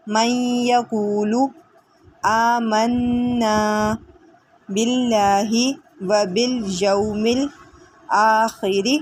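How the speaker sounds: very slow recitation, vowels held long; Quranic recitation. Shown as a demonstration. Teacher reciting for students, slow and melodic. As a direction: recite each word slowly like tilawat.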